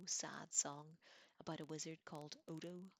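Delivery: usual speaking voice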